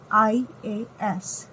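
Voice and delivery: IAS drawn out slowly, each letter apart; slow sounding out. Shown as a demonstration. Teacher letting students hear the opening vowel, slowly. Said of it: "IAS आ रहा है".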